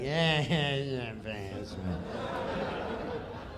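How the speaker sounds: quiet, low, unbothered mumble; low, mumbled indifference. Retold or imitated. Norm shrugging off his castmates, quiet low mumble. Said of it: "just looking at us like".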